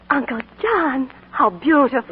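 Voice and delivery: breathless at the gift; delighted gasp. Acted. Gasps at ring gift.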